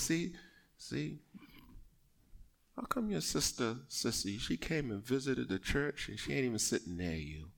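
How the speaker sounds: the devil, murmured low and quiet; devil's hushed voice. Acted. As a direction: tempt softly, as a sly devil. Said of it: "and the devil says".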